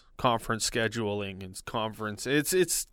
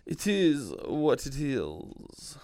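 stretched very slowly, pitched higher; drawn-out; 4 semitones higher, much slower than usual. Acted. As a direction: draw every word out long and high. Playful drawn-out echo of S1's catchphrase.

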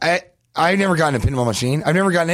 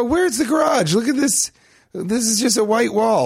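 confused shouting; 8 semitones higher, slower than usual. Retold or imitated. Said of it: "I go".

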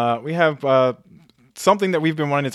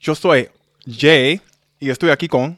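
lucha libre announcer; 4 semitones higher, 5 dB louder than usual. Acted. Podcast intro announcer over music.

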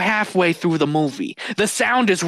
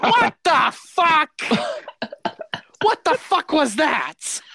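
higher, slow disbelieving outburst; retold outburst; 9 semitones higher, slower than usual. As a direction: stretch it out in stunned outrage. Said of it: "And I went".

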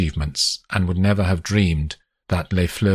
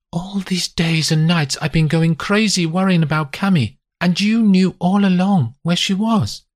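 higher, sharp accusing wife's voice; 13 semitones higher, 3 dB louder than usual. A narrator's reading. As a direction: voice a betrayed mother, pitched up and pointed. Wife voiced confronting husband.

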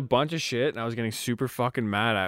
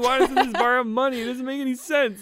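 high, loud, frantic complaint; frantic rant; 11 semitones higher, 6 dB louder than usual. Retold or imitated. Stuck behind the bar without change, high frantic rant. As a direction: rattle it off, freaking out. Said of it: "freaking out".

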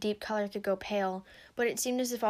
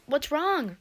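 high-pitched, worried question; alarmed cry; 6 semitones higher, 3 dB louder than usual. A narrator's reading. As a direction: ask urgently, voice lifted in alarm. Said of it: "Cottontail cried, rushing to him".

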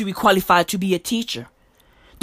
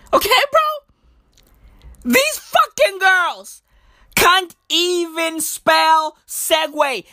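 high, slow, drawn-out disbelief; mock outrage; 6 semitones higher, slower than usual. Real, not acted. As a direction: stretch every word high in exasperation. Repeats complaint in mock outrage.

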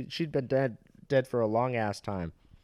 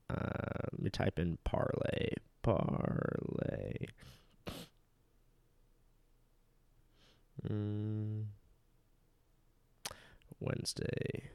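muttering to himself; 14 dB quieter, much slower than usual. Real, not acted. Muttering to himself while searching phone.

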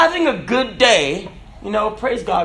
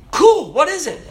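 eager husband; 9 semitones higher than usual. Retold or imitated. Husband asking wife in joke.

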